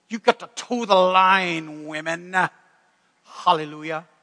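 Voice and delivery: slow, hammed-up pulpit command; mock preacher. Preacher telling women to toe the line, mock pulpit delivery. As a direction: parody a preacher drawing out the key words. Mimics preacher misusing submission verse.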